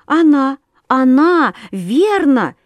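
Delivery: put-on high, loud eager girl; excited. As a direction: blurt it out excitedly. Katya's excited whisper.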